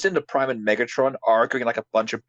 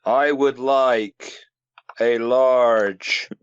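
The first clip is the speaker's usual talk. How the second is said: very slow, pauses between phrases; overly serious halting delivery; dragged-out Optimus joke; much slower than usual. Acted. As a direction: order food like a ponderous hero. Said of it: "stop dragging this joke out".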